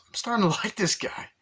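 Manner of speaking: high-pitched put-on voice; Tom Arnold. Agent listening in, hammed-up high-pitched admiration. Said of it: "Tom Arnold goes".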